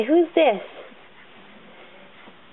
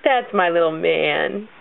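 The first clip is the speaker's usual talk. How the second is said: tender; 14 semitones lower, slower than usual. Real, not acted. Praising the baby.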